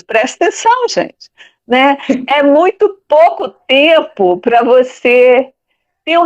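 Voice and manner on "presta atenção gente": high, sharp teacher's call to pay attention; teacher telling off. Retold or imitated. Teacher calling her students to pay attention, high and insistent. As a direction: snap the class to attention, pitched up. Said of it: "Como eu dizia pros meus alunos".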